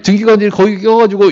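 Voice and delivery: ordinary talk, nothing marked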